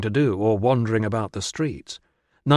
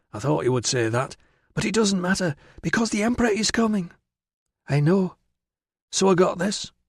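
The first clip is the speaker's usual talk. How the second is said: put-on higher-pitched, confident girlish voice; eager, undeterred girl; 8 semitones higher than usual. Virana shows Tilla what she got, eager girl. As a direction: brush the objection aside in a high, eager voice.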